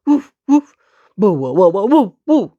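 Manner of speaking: loud dog barks; loud woofing. Acted. Second dog barking back at the first. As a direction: bark out woof, woof. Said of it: "barked the second dog".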